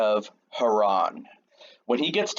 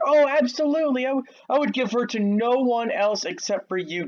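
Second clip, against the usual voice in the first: excited uncle; 9 semitones higher than usual. Retold or imitated. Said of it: "Laban's so excited".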